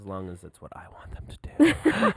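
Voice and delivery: mock-bossy whispered aside; joking whisper. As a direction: whisper it like a sly parent. Joking about controlling the kids.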